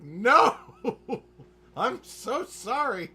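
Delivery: high, loud, drawn-out apology through laughter; mock horrified wail. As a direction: wail it in hammed-up dismay, laughing. Retells own horrified apology, laughing.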